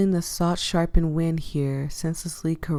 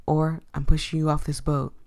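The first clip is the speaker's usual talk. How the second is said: ordinary talk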